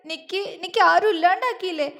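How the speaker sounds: high, stammering sobbed pleading; wailing cry. A narrator's reading. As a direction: cry it out in broken gasps. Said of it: "അവൾ ഉറക്കെ അലറിക്കരഞ്ഞു".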